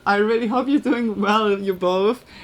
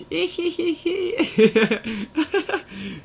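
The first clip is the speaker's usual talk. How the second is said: witch cackle; 9 semitones higher than usual. Pretend. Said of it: "Can you make a witch, witch voice sound?".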